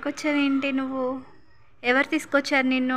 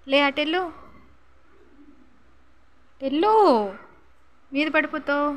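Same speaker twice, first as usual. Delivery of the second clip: shooing off; 5 semitones higher than usual. Real, not acted. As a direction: push the dog away with sharp calls. Shooing the dog away.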